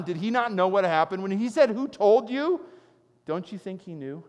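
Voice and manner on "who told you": high, loud questioning; the Lord asking. Acted. The Lord questioning Adam in the garden, high and loud. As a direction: press the question home.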